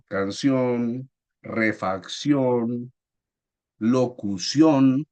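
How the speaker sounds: "Canción, refacción, locución", each drawn out on its final syllable; stress on last syllable. Shown as a demonstration. Teacher giving examples of agudas, slow stress on final syllables of "Canción, refacción, locución". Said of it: "su sílaba tónica está en la última sílaba".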